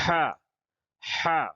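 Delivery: ہا pushed out from the throat with strong breath. Shown as a demonstration. Teacher showing a throat letter, ہا from the throat. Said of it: "تو اس کو حلق سے ادا کریں".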